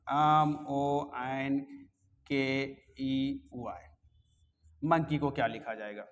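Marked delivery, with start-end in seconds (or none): 0.1-3.8 spelling it out slowly, letter by letter. Shown as a demonstration. Teacher spelling a word for students, slowly letter by letter. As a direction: pause on each letter so students can write.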